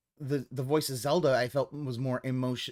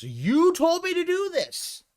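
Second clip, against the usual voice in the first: much higher, louder whiny accusation; whiny blaming; 6 dB louder, slower than usual. Retold or imitated. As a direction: whine like a kid blaming others. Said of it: "he needs to be able to blame his audience".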